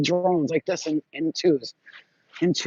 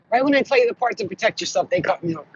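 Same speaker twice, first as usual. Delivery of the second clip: ordinary talk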